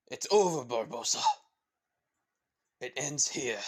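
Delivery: loud, forceful heroic declaration; Will. Acted. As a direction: call out a challenge to the villain. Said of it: "With then instantly Will says".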